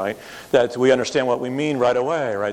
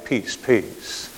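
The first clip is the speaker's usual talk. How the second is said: hushed repeat; 3 semitones lower, 4 dB quieter than usual. Shown as a demonstration. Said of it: "repetition, right?".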